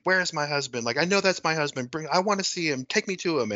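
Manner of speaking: half-screamed hysteria, toned down for retelling; mock hysterical demands. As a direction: plead urgently as a distraught wife, lightly acted. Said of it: "screaming hysterically at".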